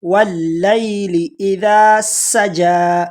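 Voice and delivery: very slow, intoned recitation; slow Quran recitation. Shown as a demonstration. Teacher reciting the full verse for students, slow intoning. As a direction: chant the verse slowly and evenly. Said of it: "یہاں پر سین کو ہم پڑھیں گے".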